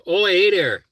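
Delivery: light mock Canadian "Oh, hey there"; a friendly northern hello; mild imitation of a Canadian; Canadian accent. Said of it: "a lot of Canadians say".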